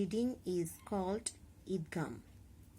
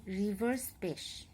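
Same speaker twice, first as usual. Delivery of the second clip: normal talking voice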